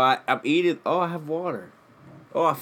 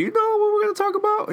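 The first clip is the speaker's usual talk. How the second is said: high-pitched quote; 3 dB louder, faster than usual. Retold or imitated. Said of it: "I'm like".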